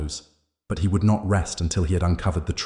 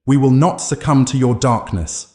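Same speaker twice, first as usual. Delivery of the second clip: loud, raised battle cry; defiant shout; 7 semitones higher, 7 dB louder than usual. A narrator's reading. Jake chanting against the darkness, loud defiant shout. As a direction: pour everything into a high, forceful shout. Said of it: "Jake shouted, pouring everything he had".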